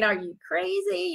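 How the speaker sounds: hushed, high self-questioning; inner voice of doubt. Retold or imitated. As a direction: lightly act out a doubting thought, softly. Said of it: "my mind was saying".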